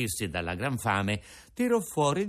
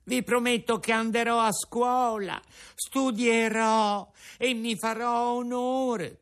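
high, slow pleading puppet; tearful puppet pleading; 11 semitones higher than usual. Acted. Pinocchio pleading with Geppetto about school, high slow plea. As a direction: beg to be believed.

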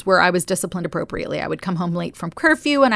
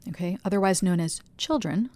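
ordinary talk, nothing marked

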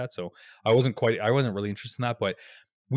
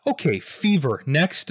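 brisk nurse; 6 semitones higher, 6 dB louder, slower than usual. Retold or imitated. Mimicking a curt triage nurse.

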